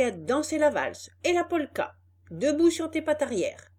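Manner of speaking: loud, scolding boss; angry boss. Acted. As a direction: yell orders at the donkey.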